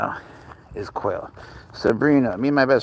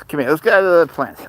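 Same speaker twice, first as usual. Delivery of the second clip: ordinary talk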